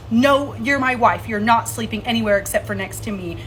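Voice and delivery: firm quoted command; retold insistent order. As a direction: imitate a possessive partner laying down the law. Retells partner's possessive order.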